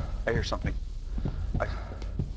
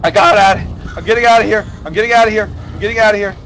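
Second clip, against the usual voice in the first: high, loud repeated escape cry; panicked relief; 6 semitones higher, 6 dB louder, much faster than usual. Real, not acted. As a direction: still worked up from the scare, voice raised. Fleeing right after the scare.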